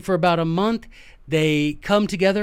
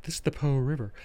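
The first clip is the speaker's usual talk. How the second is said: professional podcaster; 3 semitones lower, 6 dB quieter, faster than usual. Pretend. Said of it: "if I were a professional podcast".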